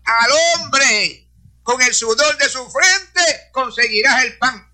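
stretched-out scriptural declamation; proclaiming scripture. Real, not acted. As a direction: proclaim the verse slowly and emphatically. Preacher proclaims a quoted Bible verse.

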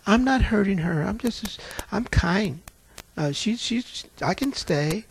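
soft, half-whispered innocent plea; sweet, demure. Retold or imitated. Demon pleads softly with pastor, demure. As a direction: coax him to leave you be. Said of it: "just as sweet and demure".

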